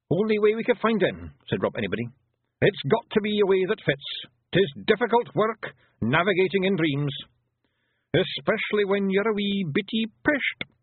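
high Scottish pictsie voice; lively little Scottish warrior; Feegle explaining. Acted. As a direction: explain it as a wee Scots fighter.

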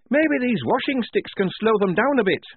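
high, nervous quaver. A narrator's reading. Soldier facing the walking dead, quavering. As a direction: offer it fearfully, pitched right up. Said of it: "Shufti quavered".

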